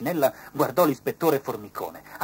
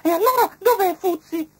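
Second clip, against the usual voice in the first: very high, loud ladybird voice; Mrs Ladybird's voice; 10 dB louder than usual. A narrator's reading. Ladybird asking where Fuzzi is, very high. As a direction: squeak the question up high. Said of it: "chiese la signora Coccinella".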